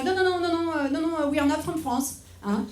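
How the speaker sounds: French tourist badly pretending to be American; French accent. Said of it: "pretend to be from somewhere else".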